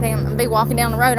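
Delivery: ordinary delivery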